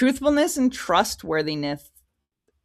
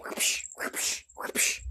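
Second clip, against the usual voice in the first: whip whoosh; 9 dB quieter, much slower than usual. Retold or imitated. Imitated whip lashes.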